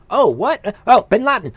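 feigned sudden discovery; mock surprise. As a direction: act as if the news just broke. Mimics staged breaking-news reaction.

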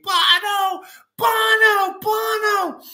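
squealed very high, over and over, like fangirls; screaming women. Retold or imitated. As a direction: shriek the name like a swooning fan. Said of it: "all the chicks in the church are screaming".

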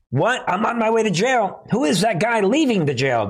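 high-pitched mock disbelief; incredulous new inmate. New inmate jailed over a lawnmower asks about another prisoner, incredulous. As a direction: pitch it up like a baffled convict.

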